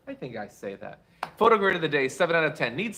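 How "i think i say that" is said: low, soft reassertion; subdued repeat. Real, not acted. Host settling how they say a viewer's name, subdued. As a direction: drop low and soft, as if to yourself.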